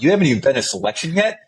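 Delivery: high-pitched disbelieving challenge; retold outrage climbing into a laugh; incredulous. Soldier calling out a guy with unearned stickers, incredulous.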